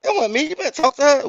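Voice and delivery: very high, pleading with the police; high pleading protest. Retold or imitated. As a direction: beg the cops to look at her. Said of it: "I'm like".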